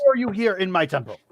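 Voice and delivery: high, rapid indignant question; exasperated demand. Acted. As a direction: demand an answer from the intruder, high and fast. GM voices angry temple occupant.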